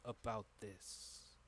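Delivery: hushed, halting conspirator's warning; Itamir, grave and secretive. Acted. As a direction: lean in and warn them softly, with weighty pauses. Game master voices Itamir again.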